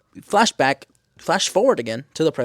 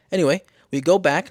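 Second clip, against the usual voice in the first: usual speaking voice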